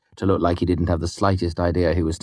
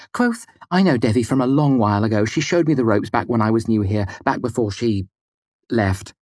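higher, hurried woman's plea; speaking quickly; 6 semitones higher than usual. A narrator's reading. Mola explains how she knows Devi, hurried and placating. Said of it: "speaking quickly".

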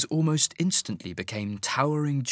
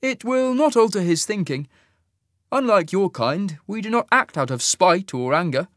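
much higher, measured, louder officer voice; cool alien officer; 8 semitones higher, 4 dB louder than usual. Acted. Fade officer to Sasha, a cool higher suggestion. Isaac answers calmly.

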